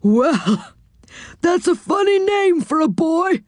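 high, comical character voice; odd saucepan man, high-pitched; quirky character voice. Acted. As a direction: pipe it up high in bemusement. Saucepan man comments on misheard name.

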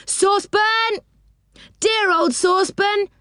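high, drawn-out calls across the streets; calling out. Acted. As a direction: call out for a lost friend. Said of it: "calling loudly as they went".

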